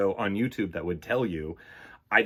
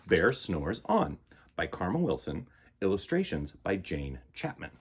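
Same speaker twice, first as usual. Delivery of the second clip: formal title reading; 3 semitones lower, 4 dB quieter than usual. Shown as a demonstration. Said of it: "clears throat".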